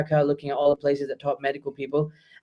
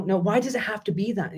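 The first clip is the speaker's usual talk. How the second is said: ordinary talk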